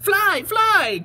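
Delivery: high, loud bird cry; calling bird. Acted. Birds call a warning to the bees, high and loud. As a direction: call out as a frantic little bird. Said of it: "called the birds to the bees".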